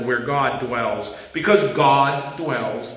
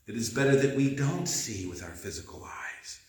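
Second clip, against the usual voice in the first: much softer, almost to oneself; soft repeat; 6 semitones lower, 10 dB quieter than usual. Shown as a demonstration. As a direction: let it sink in, quietly. Repeats the line, second softer.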